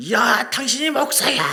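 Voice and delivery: slow, loud, high angry shout; yelling. Retold or imitated. Pastor shouting out his frustration at Haeundae, slow yell. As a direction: yell it into the open air. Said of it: "그래서 소리 질러".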